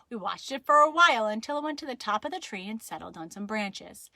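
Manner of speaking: usual speaking voice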